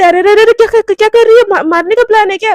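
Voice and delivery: high, loud flustered stammer; alarmed panic. Acted. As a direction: back off a threat in a panic.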